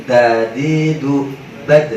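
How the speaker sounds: Dadi dubad drawn out very slowly; slow drill. Shown as a demonstration. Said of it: "Jangan begitu".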